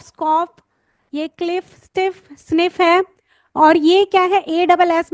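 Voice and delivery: usual speaking voice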